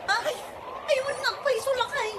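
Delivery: high, frantic cries of alarm; frantic cry. Acted. Wife crying out in fear as the flood comes. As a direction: shriek out in panic as the water rises.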